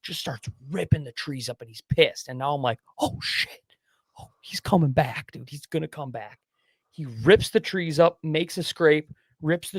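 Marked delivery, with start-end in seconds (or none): 3.0-6.4 urgent whispered panic; tense whisper. Retold or imitated. Hunter in tree stand as an angry buck approaches, tense whisper. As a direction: whisper so the buck won't hear. Buck raking trees close by.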